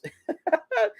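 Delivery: a sudden squeaky laugh; high laugh. Real, not acted. Viewer cracking up at the roast with a laugh.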